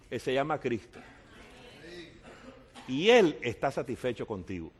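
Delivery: usual speaking voice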